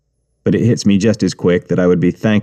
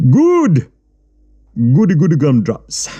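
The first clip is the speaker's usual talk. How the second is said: mocking cheer; 5 semitones higher than usual. Gangster mock cheer.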